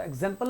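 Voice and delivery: एग्जाम्पल with a brief 'zem'; don't stretch the middle of एग्जाम्पल; एग्जाम्पल, second vowel kept short; wrongly short vowel. Shown as a demonstration. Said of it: "एग्जाम्पल नहीं होता".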